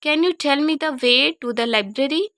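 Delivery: slow, loud teacher modelling; slow model sentences. Shown as a demonstration. Teacher modelling questions for students to repeat, slow and loud. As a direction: say each word slowly and clearly for learners to copy. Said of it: "जिस तरह से मैं बोल रही हूँ, आप same उसी तरह से बोलिए".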